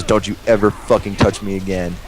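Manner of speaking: ordinary talk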